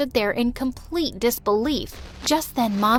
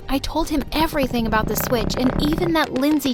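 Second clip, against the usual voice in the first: usual speaking voice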